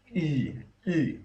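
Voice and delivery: "e" as a tight grunt-like vowel, falling from high; non-English vowel demo. Shown as a demonstration. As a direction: say "e" like the grunt of someone punched. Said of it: "the sound we don't really have".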